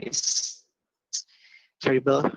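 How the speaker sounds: breaking up over a bad line; choppy audio dropping out; bad connection. Real, not acted. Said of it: "you're breaking up".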